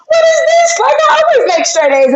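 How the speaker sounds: half-joking squeal of disbelief; mock boohoo crying. Retelling her law school D to the host, in a high mock wail. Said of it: "I boohoo cried and I was like".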